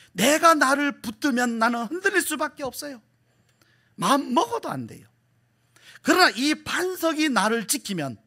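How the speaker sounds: high-pitched, insistent preacher's declarations; impassioned preaching. Real, not acted. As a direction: drive each contrast home to the congregation. Preaches with force.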